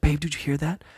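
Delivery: hushed, mildly startled imitation of wife. Wife hears noises outside at night, mildly startled and hushed. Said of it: "my wife got real startled".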